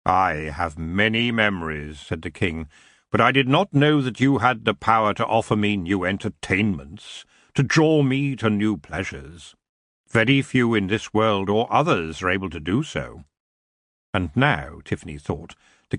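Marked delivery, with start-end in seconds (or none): 0.0-2.0 lightly lowered, lordly elf king; a hint of a lazy, regal king; the elf king greeting Tiffany with cool amusement. 3.1-13.2 lightly lowered, lordly elf king; a hint of a lazy, regal king; the elf king greeting Tiffany with cool amusement.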